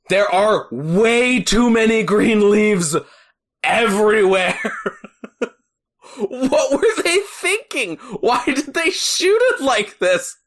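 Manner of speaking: high, slow, exasperated rant; mock outrage. Real, not acted. Host ranting to co-host about summer leaves in winter, incredulous. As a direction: drag out the disbelief, voice climbing.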